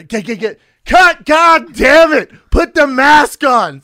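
high, loud, slow shout; furious director yell. Retold or imitated. Director losing it at extra removing mask, furious yell. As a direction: explode with rage at the actor. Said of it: "director yells again".

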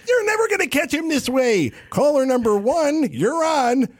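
high, fast radio patter; Vizzini host. Retold or imitated. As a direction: cut to the caller. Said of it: "he goes".

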